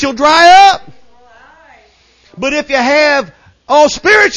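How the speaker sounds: high, loud pulpit delivery; full-force preaching. Real, not acted. Preacher telling church about Spirit and word, full-force preaching. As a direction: drive each phrase home to a roused congregation.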